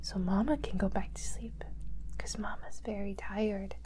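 soft breathy night-time whisper. Real, not acted. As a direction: hush so no one wakes. Tired whisper to baby.

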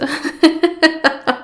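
falling wicked chuckle; evil laugh. Acted. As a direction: laugh while relishing the taste. Goddess relishing the taste.

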